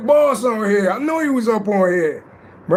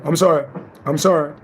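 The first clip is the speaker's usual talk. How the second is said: ordinary delivery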